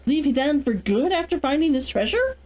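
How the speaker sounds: ordinary delivery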